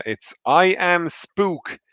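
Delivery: high, loud, slow and bewildered over the phone; shop assistant mangling the title. Retold or imitated. Shop clerk reads the mangled title down the phone, high and slow. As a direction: drag it out like a clueless clerk reading a label. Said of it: "And she said".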